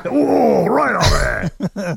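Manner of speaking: high, drawn-out mock moan. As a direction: stretch it out high, teasing the innuendo. Mock moan echoing co-host's innuendo.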